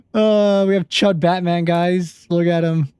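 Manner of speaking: high, loud laughing show-off; cracking up while presenting the drawing; laughing delight. Real, not acted. Streamer laughing as he shows viewers his Batman, giggling.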